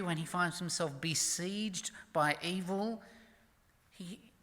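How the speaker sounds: ordinary talk, nothing marked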